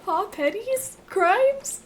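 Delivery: high squeaky disbelief; incredulous. Real, not acted. Incredulous squeal at game item.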